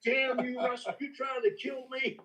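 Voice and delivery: high, laughing mock fury; mock outrage. Booker after spitting out the bourbon, laughing mock outrage. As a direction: roar at your prankster friend, laughing. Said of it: "He goes".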